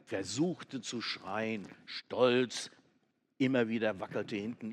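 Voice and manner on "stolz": ordinary delivery, nothing marked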